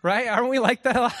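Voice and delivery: ordinary delivery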